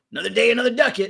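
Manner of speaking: fast, high, hammed-up old-timey reply; mock archaic answer tossed off quickly; mock pompous reply. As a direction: rattle it off like a stuffy monk scribe. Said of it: "somebody calls you up and is like".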